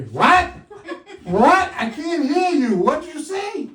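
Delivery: high, loud laughing "what?"; mock deafness. Comic pretends not to hear the crowd, loud laughing.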